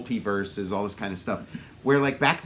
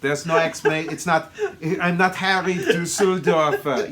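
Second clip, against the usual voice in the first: thick mock Austrian accent; Schwarzenegger; 3 dB louder than usual. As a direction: introduce yourself in a hammy Austrian accent. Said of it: "he's like Harry Rehnquist".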